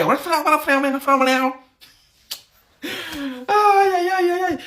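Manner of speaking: high-pitched wailing lament; exaggerated high moan of embarrassment; mock dismay. Real, not acted. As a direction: wince aloud in a high, drawn-out string. Mock dismay at her English accent.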